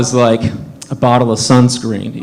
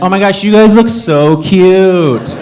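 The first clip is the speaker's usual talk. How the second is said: higher, slow drawn-out coo; gushing woman; 5 semitones higher, 3 dB louder, slower than usual. Retold or imitated. Woman stopping two dads to gush, higher and drawn out. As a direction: imitate a stranger fawning over a couple.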